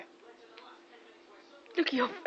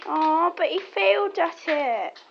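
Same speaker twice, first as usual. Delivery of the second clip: higher, louder, slow aww; mock pity; 5 semitones higher, 9 dB louder, slower than usual. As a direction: pity the dog theatrically.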